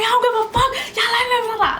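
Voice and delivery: very high, reckless defiance; raging. Retold or imitated. Held back by cousins, very high reckless defiance. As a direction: brush them off in a rage. Blacked-out rage retold.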